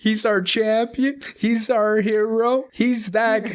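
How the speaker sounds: high, slow, hammed-up awe; mock hero worship. Classmates hailing the kid as their hero, high and slow in mock awe. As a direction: gush over him like a champion.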